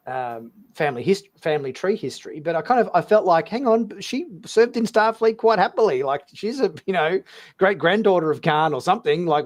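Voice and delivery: ordinary delivery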